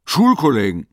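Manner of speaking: sudden loud exclamation; calling out. A narrator's reading. Investigator calls out his idea to Leo, loud. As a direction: blurt it out loud as if struck by an idea. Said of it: "Dann rief er".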